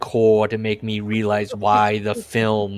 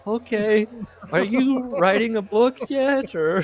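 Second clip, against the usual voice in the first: higher mock wife voice, pushing; wife asking husband about his book, higher mock voice; impatient wife; 11 semitones higher than usual. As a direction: nag him gently about the book in a higher voice. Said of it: "And she's like".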